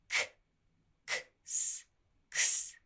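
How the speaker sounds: "k", "k", "s" apart, then "ks" joined; sounds split, then blended. Shown as a demonstration. Teacher breaking down "ks" for the class, unvoiced. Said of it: "can be like a K and S sound".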